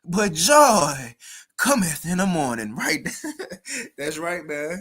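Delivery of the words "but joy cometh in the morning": slow, drawn-out mock church oratory; higher, louder, stretched-out verse reading; mock Kamala Harris. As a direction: read it as a teasing imitation of her speech. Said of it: "a little folly moment".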